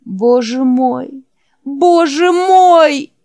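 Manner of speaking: slow, high, loud wail growing stronger; anguished cry. Acted. Jack wailing after Wolf vanishes, slow high cry building. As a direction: grief breaking out of him.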